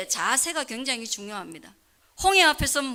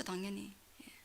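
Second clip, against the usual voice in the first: whispered aside; 3 semitones lower, 19 dB quieter than usual. Real, not acted. Aside to congregation.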